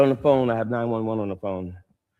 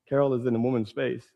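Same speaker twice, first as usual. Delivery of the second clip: ordinary talk, nothing marked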